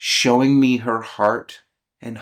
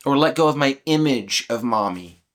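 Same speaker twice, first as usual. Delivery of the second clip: ordinary talk, nothing marked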